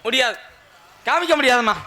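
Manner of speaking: ordinary talk, nothing marked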